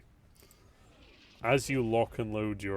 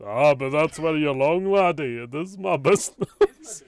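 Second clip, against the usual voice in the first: high, loud Scottish brogue, laughing through it; a Scottish thief breaking into laughter; Scottish accent; 7 semitones higher, 13 dB louder than usual. Acted. Thief taunting the laddie, loud Scottish brogue. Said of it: "'cause he's really Scottish".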